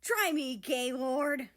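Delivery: high, loud cheeky taunt; taunting yell. Acted. Japan taunting America mid-chase. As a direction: throw it back over your shoulder.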